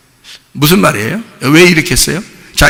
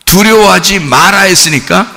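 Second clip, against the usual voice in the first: normal talking voice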